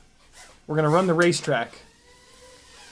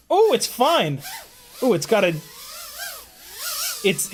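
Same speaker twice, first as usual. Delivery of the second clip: usual speaking voice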